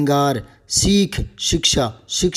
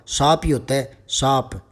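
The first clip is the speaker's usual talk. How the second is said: ordinary talk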